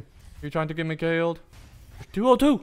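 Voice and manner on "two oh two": high, loud triumphant call; excited shout. Real, not acted. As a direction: cry out relieved. Relief/excitement.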